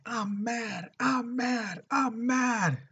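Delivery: high, wailing mock yell held back in volume; imitated frenzied yelling. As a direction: replay a crazed attacker's repeated cry, high-pitched. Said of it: "He's all yelling as loud as he can".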